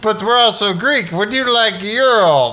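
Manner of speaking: high, loud vampire sales pitch; vampire salesman. Pretend. Vampire pushing gyro and baklava on donors, hammed-up. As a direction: sell the baklava like a sly vampire.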